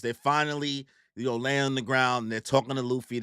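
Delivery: ordinary talk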